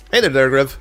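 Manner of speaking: normal talking voice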